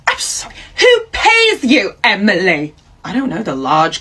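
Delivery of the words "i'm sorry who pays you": high, wailing indignant squeal; shrill outrage. Acted. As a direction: squeal in disbelief at the cheek. Karen outraged at employee defiance.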